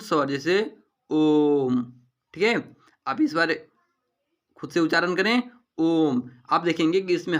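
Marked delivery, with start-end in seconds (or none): 1.1-1.9 ओम with a very long drawn-out O; prolonged vowel. Shown as a demonstration. Teacher shows an extra-long vowel, ओम stretched out. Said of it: "अधिक समय लगे". 5.8-6.2 ओम with the O held long; prolonged vowel. Shown as a demonstration. Teacher has students pronounce it, ओम drawn out long. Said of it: "अधिक समय लगे".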